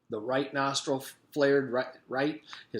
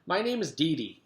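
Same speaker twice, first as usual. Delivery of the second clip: put-on higher, louder little girl voice; a bouncy little girl's voice; small, energetic girl; 6 semitones higher, 4 dB louder than usual. A narrator's reading. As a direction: bounce it like a super ball. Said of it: "Her voice was small but full of energy".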